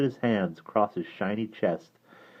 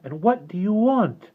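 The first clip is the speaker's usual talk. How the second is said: mild fear; 9 semitones higher than usual. Acted. Derna answers the creature.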